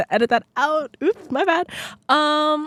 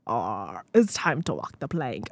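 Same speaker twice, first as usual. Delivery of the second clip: lightly voiced pirate, dropped low; a casual, low pirate bit; hint of a pirate, softer and deeper; 8 semitones lower, 4 dB quieter, slower than usual. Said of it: "it's gonna be pirate themed".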